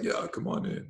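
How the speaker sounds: lightly done bad impression, voice sliding up; Sylvester Stallone. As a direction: horrible impression, thrown out quietly. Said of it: "I'm doing a horrible Stallone".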